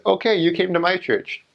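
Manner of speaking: high, satisfied voice; pleased church lady. Retold or imitated. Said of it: "and says".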